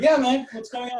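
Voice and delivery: high, loud retold reply; mock eager concern. Student answers troubled stranger at his table, mock eager concern. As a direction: overplay the urgent interest.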